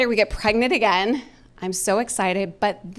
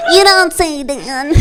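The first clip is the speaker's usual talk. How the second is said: sarcastic; 6 semitones higher, 7 dB louder, slower than usual. Retold or imitated. Sarcastic reply to her husband.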